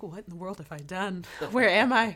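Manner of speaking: rising to a loud, dismayed cry; hammed-up horror at the heat; mock shock. Singer stepping out of her car into the heat, mock shock.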